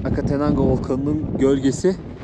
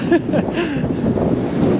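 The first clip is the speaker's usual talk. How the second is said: high laugh; 12 semitones higher than usual. Real, not acted. Laugh after urging volcano.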